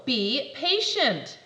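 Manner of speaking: slow, drawn-out be patient; slow scold. Acted. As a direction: stretch it to make the point.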